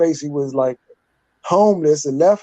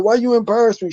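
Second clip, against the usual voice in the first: put-on upset teen protest; 7 semitones higher, faster than usual.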